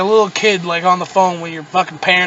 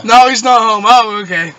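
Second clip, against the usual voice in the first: louder, pushed-up play-acted exchange; mock phone reply; 4 semitones higher, 9 dB louder than usual. Sex-line couple answers the panicked caller, loud mock exchange. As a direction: act out both sides loudly.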